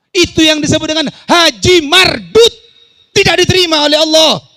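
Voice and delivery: high, shouted denunciation from the pulpit; full-force preaching. Acted. Preacher declares the pilgrimage rejected by Allah, full-force preaching. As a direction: proclaim it at the top of your voice.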